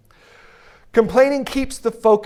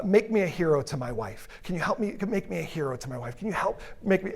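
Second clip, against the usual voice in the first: softly asking; 8 dB quieter than usual. Shown as a demonstration. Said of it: "if you say something like".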